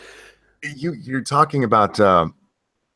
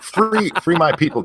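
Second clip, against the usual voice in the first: rally the crowd like a jokey rebel leader; mock rallying cry; 7 semitones higher than usual. Host jokingly rallying to free his Irish ancestors, hammed-up cry.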